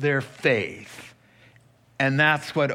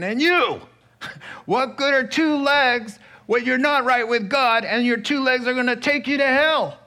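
high, louder laughing comeback; Jesus answering the heckler, amused; retort; 8 semitones higher, 4 dB louder than usual. Retold or imitated. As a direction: fire back with a chuckle.

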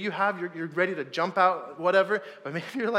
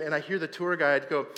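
ordinary delivery

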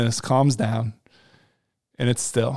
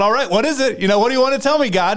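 raised, higher pleading call to God; 8 semitones higher, much faster than usual. Retold or imitated. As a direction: call up to the sky, asking for an answer. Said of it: "just kinda calling out".

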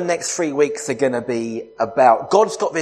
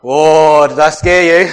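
playful teasing; 2 semitones higher, 9 dB louder than usual. Real, not acted. Teasing after scaring audience.

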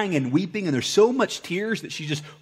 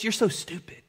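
inner voice of shame; 7 semitones higher, 4 dB quieter than usual. Pretend. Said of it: "We know that voice".

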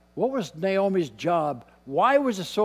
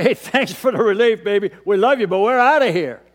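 louder, breezy brush-off, faster at the end; mock flippant daughters-in-law; 3 semitones higher, 8 dB louder, faster than usual. Daughters-in-law brushing off a bitter mother-in-law, mock breezy and loud. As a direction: toss it off like glad to be rid of her. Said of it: "They say".

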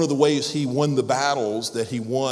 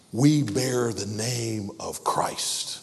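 usual speaking voice

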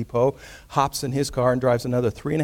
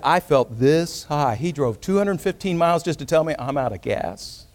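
ordinary talk

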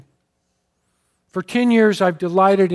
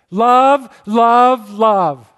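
loud, higher, calling his name in a different voice; 4 semitones higher, 7 dB louder, much slower than usual. Retold or imitated. Waking wife calls to her husband, loud and high. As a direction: cry out to him after long silence.

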